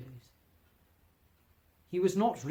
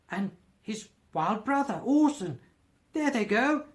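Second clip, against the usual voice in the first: high, loud, earnest excitement; pointing out beloved storybook characters, carried away; extraordinary excited voice; 8 semitones higher, 6 dB louder than usual. A narrator's reading. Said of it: "in a most extraordinary voice".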